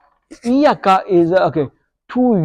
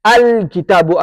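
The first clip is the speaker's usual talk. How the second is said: usual speaking voice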